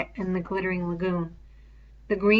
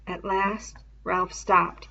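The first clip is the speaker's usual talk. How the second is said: ordinary delivery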